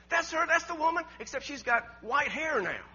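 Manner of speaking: put-on high, loud children's exclamation; excited recognition. Children pointing out the woman in a picture, excited.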